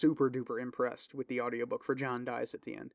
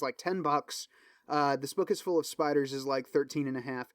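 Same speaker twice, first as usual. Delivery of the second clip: ordinary delivery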